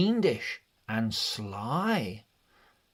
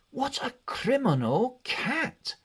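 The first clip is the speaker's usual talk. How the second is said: much higher, squeaky cry of dismay; shocked family; 12 semitones higher than usual. Acted. The woken family finding the cat's mess, high dismayed cry. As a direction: gasp it out like a startled family. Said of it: "they all said".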